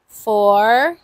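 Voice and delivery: ordinary talk, nothing marked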